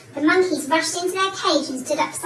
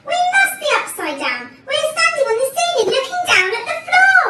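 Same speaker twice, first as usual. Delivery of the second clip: high, loud, mounting panic; horrified gasp; 11 semitones higher, 7 dB louder than usual. A narrator's reading. Mister Twit working out their predicament, horrified gasp. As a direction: build to a shout of alarm. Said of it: "gasped Mister Twit".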